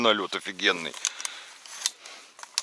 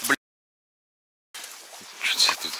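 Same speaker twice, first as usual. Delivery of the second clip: ordinary talk, nothing marked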